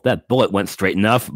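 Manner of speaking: ordinary talk, nothing marked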